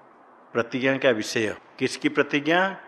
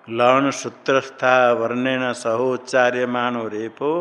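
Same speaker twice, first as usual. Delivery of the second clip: slow, measured Sanskrit recitation; recitation for repetition; 3 dB louder than usual. Shown as a demonstration. Teacher models a sutra line for pupils, slow recitation. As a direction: recite it deliberately for the class to repeat. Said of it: "बोलो".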